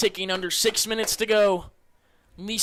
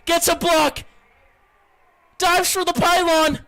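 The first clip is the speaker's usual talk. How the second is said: rising loud play call; excited call; 8 semitones higher, 4 dB louder than usual. Real, not acted. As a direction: build up as he dives. Quarterback dives for the pylon.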